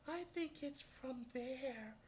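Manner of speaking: put-on high, slow kid's worried guess; a lightly voiced kid pointing it out; mock kid voice. Kids voiced in story.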